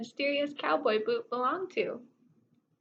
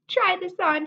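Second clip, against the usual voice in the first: higher and louder, eager; cried out eagerly; 8 semitones higher, 6 dB louder than usual. A narrator's reading. Miss Lurleen rushing up to Bubba with the boot, excited. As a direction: urge him to try the boot. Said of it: "she cried".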